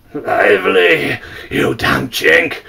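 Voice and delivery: slow, loud, menacing bark; angry captain. A narrator's reading. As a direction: drag out every word as a threat. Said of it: "said the captain".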